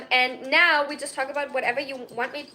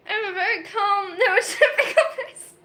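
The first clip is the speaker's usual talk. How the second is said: laughing mock claim; 7 semitones higher than usual. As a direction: pretend calm breaking into laughs. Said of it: "Am I convincing anyone yet?".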